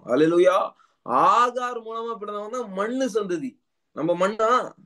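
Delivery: impassioned preaching. Real, not acted. Preacher declares believers a new creation, impassioned preaching. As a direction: proclaim it high and with conviction.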